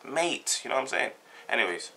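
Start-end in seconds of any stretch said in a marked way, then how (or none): 0.1-0.6 softer, higher "Mate" with an ay vowel; corrected vowel. Shown as a demonstration. Londoner repeating the correction to Cockney, "Mate" with an ay vowel. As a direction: say "Mate" to rhyme with late. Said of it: "there's no I in mate".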